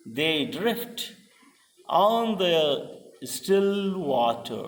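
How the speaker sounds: slow verse line broken by pauses; drawn-out, measured scansion; foot-by-foot reading. Shown as a demonstration. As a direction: read it out slowly, one foot at a time.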